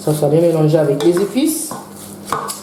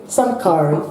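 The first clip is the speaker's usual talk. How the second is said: English words; 2 semitones lower than usual. Shown as a demonstration. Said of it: "ça sonne mieux en anglais".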